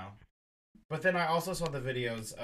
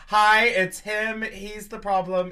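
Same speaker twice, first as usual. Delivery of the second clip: grand announcement; 8 semitones higher, 11 dB louder, slower than usual. Pretend. Dramatic announcement of the guest.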